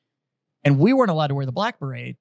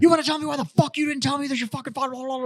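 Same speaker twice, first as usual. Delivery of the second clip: high-pitched furious yell; angry drill sergeant; 12 semitones higher, faster than usual. Retold or imitated. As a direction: drill sergeant yelling at recruit about his father, furious. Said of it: "And I was like".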